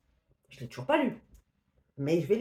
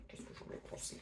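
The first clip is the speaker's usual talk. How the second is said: a hushed whisper of the question; soft whispered musing before the verdict; whispered to herself; 21 dB quieter, faster than usual. Pretend. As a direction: whisper it as if pondering alone. Muses over her verdict.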